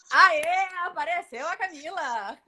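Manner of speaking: loud happy whoop; excited cheer. Real, not acted. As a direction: cheer the winner on. Second winner appears.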